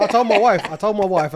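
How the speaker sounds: loud and high, bursting with excitement; can't wait to tell you this; excited. Real, not acted. Excited buildup to his funeral plan.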